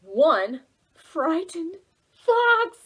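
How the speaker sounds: big slow storybook exclamation; dramatic slow exclamation. A narrator's reading. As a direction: announce the fleeing fox with drama. Fox flees.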